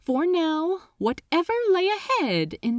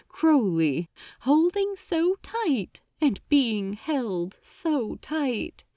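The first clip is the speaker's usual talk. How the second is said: ordinary delivery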